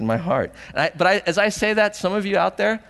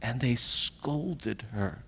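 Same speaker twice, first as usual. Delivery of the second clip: slow, much softer reading; hushed gravity; 3 semitones lower, 10 dB quieter, much slower than usual. A narrator's reading. Preacher repeating how they scolded Mary, hushed and grave. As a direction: let it sink in softly and slowly.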